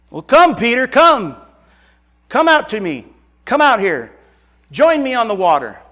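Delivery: Jesus calling Peter to step onto the water, loud and warm. Acted. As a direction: call him out over the wind, welcoming.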